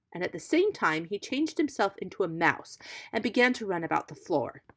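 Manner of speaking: usual speaking voice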